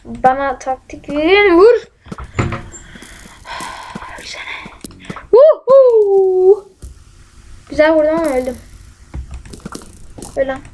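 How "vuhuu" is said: high, loud whoop; drawn-out cheering woohoo; gleeful "woohoo". Real, not acted. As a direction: whoop in triumph. Cheering a hit in the game.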